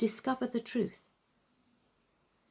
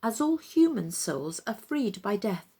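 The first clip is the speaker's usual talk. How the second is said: ordinary delivery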